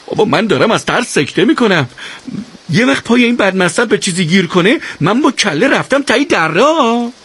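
panicky, voice climbing as he speaks; scared rider. Acted. Siavash on the mule, terrified of the cliff edge, panicky.

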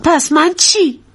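high, hurt little protest; jealous protest. Acted. Wife protests as husband dotes on his mother, jealous.

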